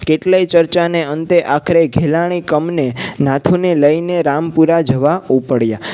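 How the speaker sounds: plain reading voice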